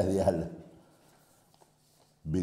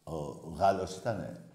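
usual speaking voice

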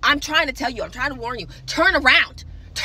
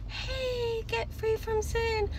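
soft, much softer and higher; mock whisper; 8 semitones higher, 13 dB quieter, much slower than usual. Whispering a warning to sinners, mock hushed. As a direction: breathe it out gently, barely there. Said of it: "I'm not gonna whisper to".

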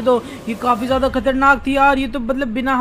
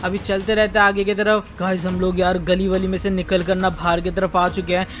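usual speaking voice

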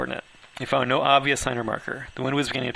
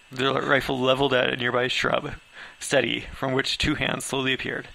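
plain reading voice

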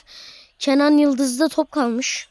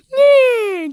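high shocked yelp; shocked cry; 9 semitones higher, 6 dB louder than usual. Real, not acted. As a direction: yelp in disbelief. Shocked shout in game.

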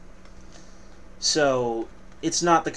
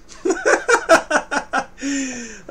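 loud laugh; 10 semitones higher, 5 dB louder than usual. Real, not acted. Laughing at own joke.

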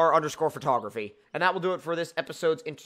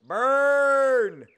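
teasing taunt; 7 semitones higher, 6 dB louder than usual. Real, not acted. Teasing friend about age.

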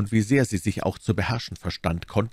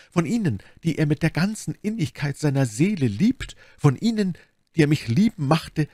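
higher, theatrical outpouring; effusive Milady; 10 semitones higher than usual. Acted. Milady claims Constanze as a dear friend, effusively. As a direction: gush with mounting feeling.

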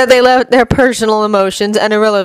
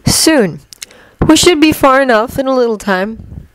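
Loki; 6 semitones higher than usual. Acted. Loki voiced by reader.

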